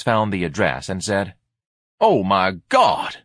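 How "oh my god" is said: slow, loud cry of dismay; horrified exclamation. A narrator's reading. Ignatius finds the woman's address, exclaiming loudly in dismay. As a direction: stretch the oath, then pitch up high.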